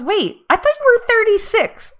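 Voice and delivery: high, loud mock-surprised quote; puzzled husband. Husband questioning wife about her age, mock surprise. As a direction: imitate a husband caught off guard. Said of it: "my husband goes".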